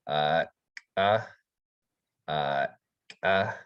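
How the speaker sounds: glottalized K syllables "uh" clicked in the throat; glottal K. Shown as a demonstration. Teacher showing the glottal K, clipped. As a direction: catch and pop each "uh" like a K. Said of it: "would sound like".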